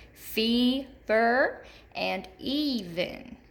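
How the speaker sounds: first syllable of "fever" and "even" drawn out slowly; stressed long E. Shown as a demonstration. Teacher showing third graders the long E, "fever" and "even" stressed on slow first syllables. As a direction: stretch the opening E in "fever" and "even". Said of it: "hear that long E in the first syllable".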